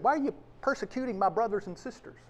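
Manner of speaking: quick, light throwaway of the wrong version; quick version of the words Jesus did not say; hypothetical wording. Acted. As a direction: rattle off "Why are you persecuting my brothers and sisters?" quickly. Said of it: "'Cause he could have said".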